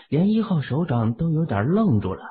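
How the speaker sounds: ordinary delivery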